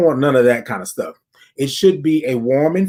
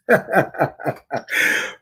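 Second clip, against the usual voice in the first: quiet unvoiced laugh; breathy laugh; 3 dB quieter than usual. Real, not acted. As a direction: laugh it off after the chant. Laugh after the groove.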